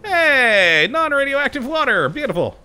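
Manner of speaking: excited. Real, not acted. Delighted by clean water.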